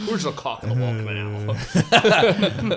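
Sean Connery impression. Mocking Connery's accent in Hunt for Red October, slow Scottish brogue. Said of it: "He was talking like Sean Connery".

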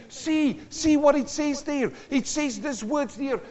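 high, eager urging; acted excitement. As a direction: eagerly show your wife a discovery. Acts excited believer telling his wife.

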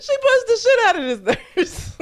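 laughing. Real, not acted. Laughing at nurse getting punched.

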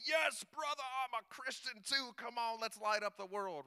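high, bright-eyed believer greeting. Retold or imitated. A churchgoer greets the evangelists, mock cheerful and high. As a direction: play the eager homeowner who welcomes you.

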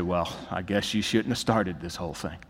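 quiet, sullen shrug of blame; sulky blame. Retold or imitated. Preacher voicing a sinner blaming God, quiet and sullen. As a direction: mutter it like a resentful excuse.

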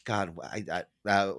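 ordinary talk, nothing marked

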